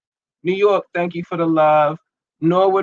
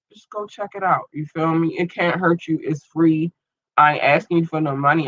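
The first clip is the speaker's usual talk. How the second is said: normal talking voice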